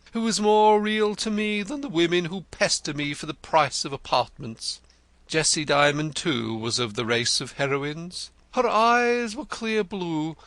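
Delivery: Denzil the poet. A narrator's reading. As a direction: play him florid and eager. Denzil rhapsodizes about Jessie.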